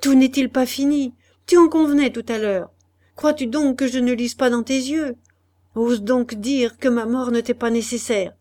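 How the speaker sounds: high, loud, bitter accusation; Madeleine accusing. Acted. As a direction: challenge him to deny it. Madeleine confronts him. (femme qui défie son mari, voix haute et forte)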